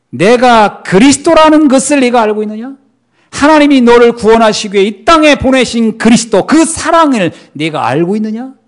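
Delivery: loud, high, earnest questioning as Jesus; Jesus questioning Peter. Acted. Jesus asks Peter if he knows the Christ, loud and earnest. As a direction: press Peter with a ringing question.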